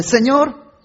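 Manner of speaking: high, loud whining protest at God; indignant shout of why at God; angry complaint. Retold or imitated. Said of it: "Y empieza a decirle".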